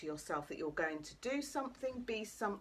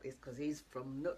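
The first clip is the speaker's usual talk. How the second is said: ordinary delivery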